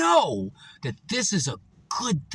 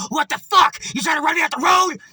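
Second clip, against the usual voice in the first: loud, high, fast angry yell; angry driver; 7 semitones higher, 6 dB louder than usual. Retold or imitated. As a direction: bark it like a furious driver. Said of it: "he was all like".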